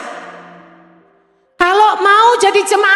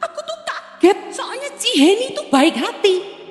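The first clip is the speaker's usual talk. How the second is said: lightly voiced startled church member, pitched up at the start; a mild mock of a surprised member, quieter; mimicking a church member; 4 dB quieter than usual. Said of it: "Maunya Bu Heni".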